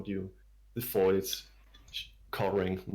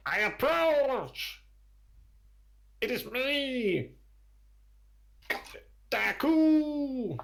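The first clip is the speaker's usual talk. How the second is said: high, slow creature shout from the dark; kobold shouting; 3 dB louder, much slower than usual. Acted. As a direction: call out high and drawn out like a little lizard sentry. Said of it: "the kobold shouts out".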